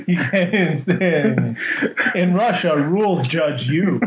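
Yakov Smirnoff impression. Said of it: "a bad Yakov Smirnoff joke".

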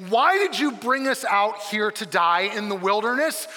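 sarcastic complaint. Acted. Israelites accusing Moses in the wilderness, heavily sarcastic. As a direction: hurl it as an angry sarcastic accusation. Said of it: "probably hear the most is sarcasm".

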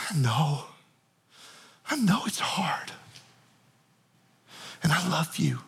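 soft hushed reassurance; tender whisper. Acted. Jesus stopping to comfort the crying sisters, soft whisper. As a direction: breathe it gently, almost a whisper.

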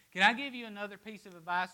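ordinary talk

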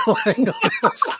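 high laughing aside. Real, not acted. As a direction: laugh through the words. Laughing while telling.

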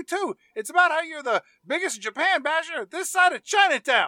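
very high-pitched pestering reporter impression; hammed-up weaselly newshound, firing questions; Steve Buscemi. Reporter hounding two detectives with a tape recorder, shrilly pestering. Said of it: "Steve Buscemi comes over with a tape recorder".

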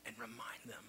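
hushed whisper; whispered reminder of the Red Sea. Real, not acted. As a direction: breathe it out softly.

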